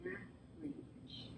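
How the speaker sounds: whispered, barely voiced; hushed revelation. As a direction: let the word fall to a whisper. Hushed punchline of the realization.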